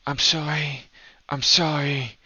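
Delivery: lightly voiced unhinged woman, each apology set apart; muttered madness. Woman approaching Ezric, almost mad, repeating her apology. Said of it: "almost madness about her".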